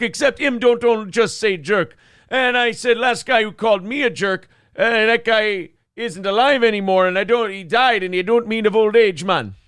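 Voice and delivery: high, loud patois mixed with Minnesota and Irish lilts; Irish Jamaican Swede accent. Acted. Bar story of a hitman, Irish Jamaican Swede accent, high and loud. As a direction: play a gangster barfly in a mashed-up accent. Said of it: "An Irish Jamaican Swede".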